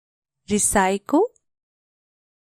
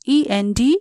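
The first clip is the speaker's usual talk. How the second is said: ordinary delivery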